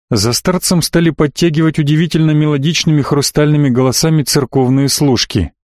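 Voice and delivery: ordinary delivery, nothing marked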